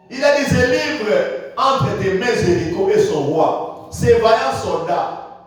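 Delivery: usual speaking voice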